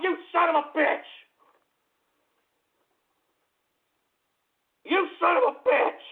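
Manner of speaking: high-pitched angry yell; outraged cursing. Real, not acted. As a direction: curse them out in outrage. Cursing the prank caller name.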